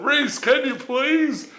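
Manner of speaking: high, drawn-out mock plea; pleading husband. Husband to his arguing wife, mock pleading. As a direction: beg her to stop, comically helpless.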